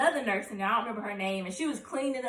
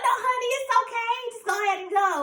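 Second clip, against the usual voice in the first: high-pitched, sing-out reassurance; imitated nurse soothing a patient; nurse coaxing; 12 semitones higher than usual.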